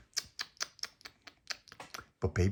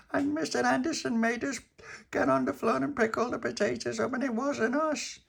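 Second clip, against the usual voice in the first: put-on very high upset little girl voice; little girl Dolores. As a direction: tell it like a wronged small girl. Dolores tells her story.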